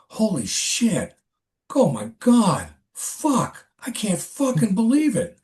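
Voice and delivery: high, stunned cursing at the pictures; shocked swearing. Retold or imitated. Coworker gasps at the photos in shock. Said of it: "I hear this guy, like, going".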